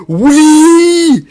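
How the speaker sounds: very high, very loud yell of joy; cheer. Real, not acted. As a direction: celebrate like a goal was scored. Fan celebrates Zoro's vow.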